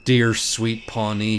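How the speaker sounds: ordinary delivery, nothing marked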